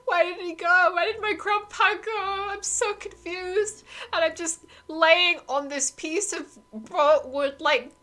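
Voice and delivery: put-on boy adrift panics after grandpa vanishes; panicked screaming. A narrator's reading. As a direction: spiral into panic on the wreckage. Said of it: "Terry's screaming and crying".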